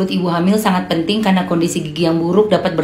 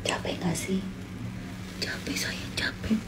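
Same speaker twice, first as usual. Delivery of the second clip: whispering over a crib; 18 dB quieter than usual. Real, not acted. Whispering to sleepy child.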